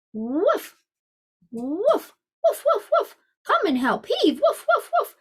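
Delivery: high playful woofs around the call; barking dog. Acted. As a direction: bark it out like a cheerful puppy. Dog calls the cat.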